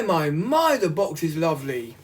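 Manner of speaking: ordinary talk, nothing marked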